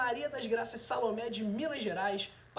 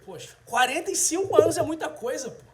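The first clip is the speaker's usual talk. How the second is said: loud, laughing through the words; real laughter in a louder voice; 4 semitones higher, 9 dB louder than usual. Real, not acted. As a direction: laugh it off while protesting. Laughs at being called old.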